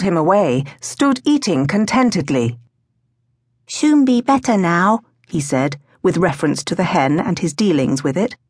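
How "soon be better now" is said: put-on higher, small-child voice; toddler's simple reassurance; little boy Toby. A narrator's reading. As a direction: speak as a very young boy.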